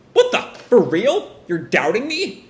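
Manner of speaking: high-pitched indignant disbelief; incredulous. Acted. Boruto suspected of plotting by Naruto, incredulous. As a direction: squeak in offended astonishment. Boruto reacts to father's suspicion.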